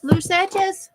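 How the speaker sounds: high playful singsong repeat. Real, not acted. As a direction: sing it up high and light.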